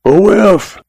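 high-pitched, restrained holler; understated storyteller's holler; mild holler. A narrator's reading. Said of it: "Then he hollers".

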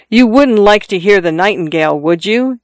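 plain reading voice

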